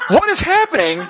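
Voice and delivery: high, loud, laughing alarm; startled. Retold or imitated. Telling the saw scare to the church, alarmed. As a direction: cry out in laughing panic. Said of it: "And I'm going".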